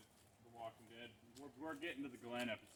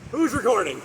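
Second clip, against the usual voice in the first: shouting question; 11 semitones higher, 22 dB louder than usual. Retold or imitated. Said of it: "I was like".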